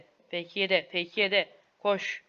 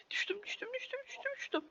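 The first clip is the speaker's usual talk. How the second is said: breathless giggling whisper; laughing whisper; 13 dB quieter than usual. Real, not acted. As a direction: whisper I fell through laughter. (gülerek fısıldamak)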